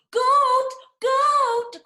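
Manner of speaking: high, very loud urgent cry. A narrator's reading. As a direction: shout for the goat up the hill. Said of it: "cried Hen".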